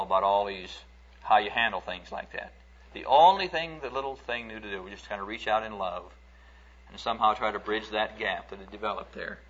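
normal talking voice

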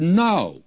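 retold firm refusal, pitched high and falling; emphatic no. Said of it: "He gives an emphatic no".

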